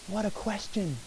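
softly amazed aside; awed hush. Real, not acted. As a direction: drop to a hush in awe. Marvels at Jesus' question.

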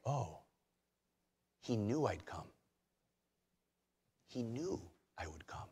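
hushed awe. Acted. Imagined believer's joyful realization.

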